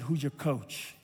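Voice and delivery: low, soft, leaned-in question; quiet, intimate question. Retold or imitated. Coach leans in to his player, quiet intimate question. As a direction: lean in and ask it gently.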